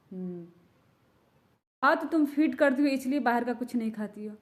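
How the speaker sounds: ordinary delivery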